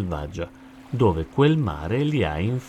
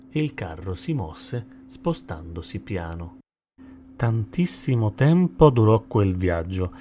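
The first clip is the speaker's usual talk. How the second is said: usual speaking voice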